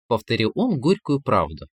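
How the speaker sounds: usual speaking voice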